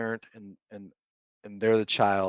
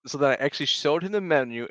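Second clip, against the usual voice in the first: ordinary delivery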